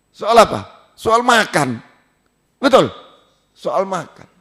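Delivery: ordinary talk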